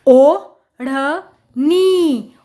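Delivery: ओ, ढ़, नी very slowly with gaps; syllable by syllable. Shown as a demonstration. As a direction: sound out ओ … ढ़ … नी piece by piece. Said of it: "जोड़कर पढ़ेंगे".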